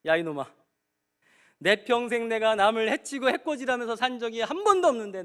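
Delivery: loud, high angry outburst at his son; angry father. Retold or imitated. Father loudly rebuking the son who preached to him. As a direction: snap back in a loud rage. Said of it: "벌컥 화를 내시는 거예요".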